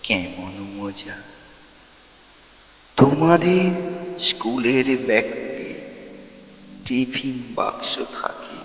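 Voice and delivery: slow, measured verse with long pauses; declaimed poem, words drawn out; poem recitation. Acted. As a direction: recite dramatically, swelling on the lines and dropping on the refrain.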